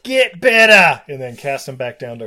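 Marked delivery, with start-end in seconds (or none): 0.0-1.0 high, loud, drawn-out bellow; mock scolding shout. Sun god dismissing his useless grandson, mock angry shout.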